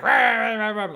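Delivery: loud, high yapping snarl, falling away; high burst of mock snarls; imitated dog snarling. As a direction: go off like a small furious dog. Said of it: "And he's like".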